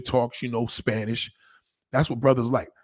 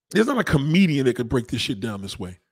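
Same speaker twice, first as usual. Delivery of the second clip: ordinary talk, nothing marked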